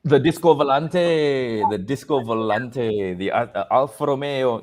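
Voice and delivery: drawn-out, hammed-up Italian accent. Shown as a demonstration. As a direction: savour the names with an Italian lilt. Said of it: "say it in an Italian accent".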